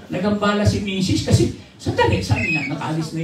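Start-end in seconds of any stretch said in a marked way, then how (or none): none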